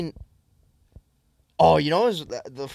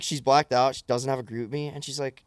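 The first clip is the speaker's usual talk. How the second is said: usual speaking voice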